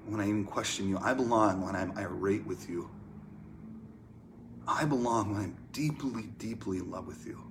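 unhurried prayer with long pauses; hushed, spacious prayer, softening at the end; heartfelt prayer. Real, not acted. Leads a closing prayer.

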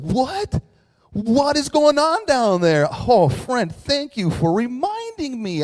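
high, loud mock astonishment; hammed-up shocked voice, stretching the words out; God, mockingly. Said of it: "I totally was mocking you".

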